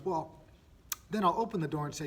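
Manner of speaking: usual speaking voice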